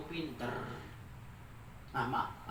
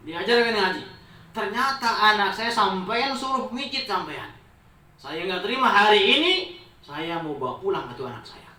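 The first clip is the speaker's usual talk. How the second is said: angry father; 6 semitones higher, 8 dB louder than usual. Acted. Angry father scolding the sheikh.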